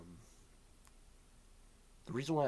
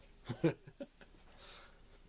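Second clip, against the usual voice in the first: breathy laugh; 6 semitones higher than usual. Real, not acted. Laugh after the joke.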